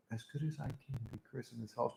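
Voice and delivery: very soft, fast mutter; barely audible mock reply; muttered response. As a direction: mutter it under your breath, quick. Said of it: "That's usually my response".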